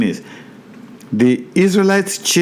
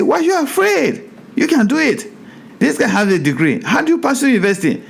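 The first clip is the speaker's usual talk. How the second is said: high-pitched, incredulous scolding of a scared coworker; pushing the man to see he can do it; exasperated pleading; 9 semitones higher, faster than usual. Retold or imitated. Pastor urging a frightened coworker at work, high and incredulous.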